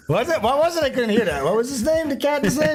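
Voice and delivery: high, laughing mock disbelief. Real, not acted. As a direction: pretend you didn't hear, laughing. Mock not hearing, laughing.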